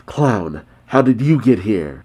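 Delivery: ordinary delivery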